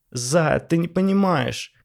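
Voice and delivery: high, slow, suffering whine; suffering wife. Acted. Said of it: "страдая, заламывала руки Ванесса".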